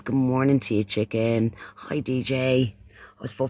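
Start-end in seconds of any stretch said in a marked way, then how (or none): none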